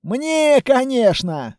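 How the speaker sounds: high, self-satisfied quip; teasing, lifted tone; cheeky chuckle. A narrator's reading. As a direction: claim the credit with a smirk. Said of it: "усмехнулся Текс".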